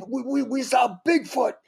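high, strained ferocious stammer; Bob Goldthwait. Retold or imitated. Comic repeating friend's punchline, ferocious stammering shout. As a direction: stutter into a high character shout. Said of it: "he's using this ferocious voice".

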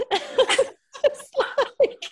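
bursts into high laughter; real delighted laugh. Real, not acted.

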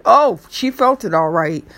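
ordinary delivery

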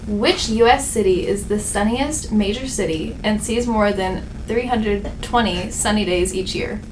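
loud, punchy game show read; quiz host read. Acted. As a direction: announce the options like a quiz host. Quiz host reads question.

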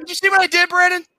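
high excited teasing; excited squeal. Real, not acted. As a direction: squeal with glee. Teasing about the smooch.

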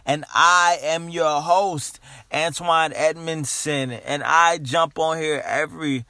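loud, high, hyped-up announcer; hype podcast intro. Acted. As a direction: pump up the crowd.

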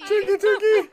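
very high squawked turkey imitation; mock turkey. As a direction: squeak the words like a badly faked gobble. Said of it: "what you think a turkey sounds like".

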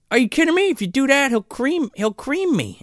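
put-on high, whiny alarmed kid voice; panicked kid. Podcaster voices frightened kid refusing to fight. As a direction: squeak it out like a scared kid. Kid's panicked reply retold.

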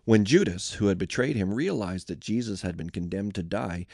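ordinary delivery, nothing marked

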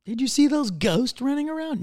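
high put-on voice asking; imagined neighbor. Neighbor spotting pale kids, high put-on voice. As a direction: pitch up like a startled onlooker.

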